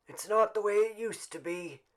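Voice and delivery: high, carrying complaint; a grumbling old man, lightly voiced; old man, said clearly. A narrator's reading. Old Man Warner to the crowd, clear and grumbling. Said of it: "said clearly".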